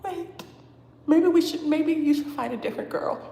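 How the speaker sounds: high, hesitant retreat, a touch nervous; timid backing off.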